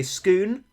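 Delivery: "scoon" with a long oo, rhyming with moon; Macbeth's Scone. Shown as a demonstration. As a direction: say "scoon" with a drawn oo vowel. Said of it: "If you're within the plot of Macbeth and you say scoon".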